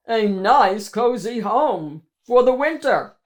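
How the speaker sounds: loud, pleased monster voice; gloating monster. Acted. Biggest monster claiming the cave, loud and gloating. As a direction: savour the words like a greedy beast.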